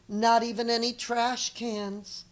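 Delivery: ordinary delivery, nothing marked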